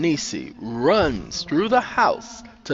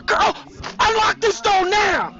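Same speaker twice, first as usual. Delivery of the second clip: very high, loud order; demanding shout; 4 dB louder than usual. A narrator's reading. Sandra locked out of Nisi's house, demanding shout. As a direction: order her to open up, shouting.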